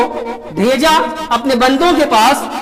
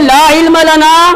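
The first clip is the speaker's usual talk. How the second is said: loud, higher, slow لا علم لنا; loud recitation; 7 semitones higher, 6 dB louder, slower than usual. Acted. Preacher declaiming the prophets' answer to the crowd, loud. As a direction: call the verse out with force.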